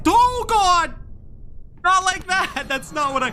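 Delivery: high, loud cry of dismay; panicked outburst. Real, not acted. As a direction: yelp in real panic, easing toward the end. Panics at game mishap.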